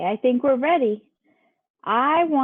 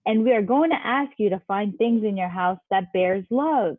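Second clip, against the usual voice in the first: usual speaking voice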